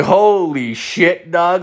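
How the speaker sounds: re-enacted stunned reaction; retold amazement. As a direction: stretch the words in mild shock. Reacting to friend's shocking story.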